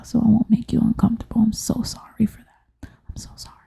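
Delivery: soft, receding apology; very soft, nearly whispered; backed off from the microphone. Real, not acted. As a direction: apologize quietly from farther away. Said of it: "backing up from the, from the microphone".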